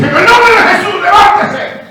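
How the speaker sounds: grito de mando, mucho más agudo y fuerte; orden gritada. Retold or imitated. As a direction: ordena con toda autoridad que se levante. Commanding the dead girl to rise. (grito autoritario de sanación)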